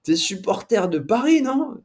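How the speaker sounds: questions plus aiguës et appuyées, voix d'un autre; imitation du journaliste. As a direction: jouer le collègue anglais curieux, la voix qui monte. Journalist questions him, retold. (le journaliste qui l'interroge sur Paris, voix aiguë imitée)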